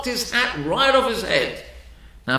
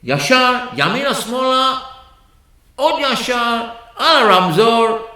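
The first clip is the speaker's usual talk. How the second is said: loud, quick street directions in Hebrew; mock brisk directions; 6 semitones higher, 8 dB louder, slower than usual. Ordinary person brushing off the stutterer, loud and curt. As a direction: rattle off directions to get rid of him. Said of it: "any ordinary person would have said".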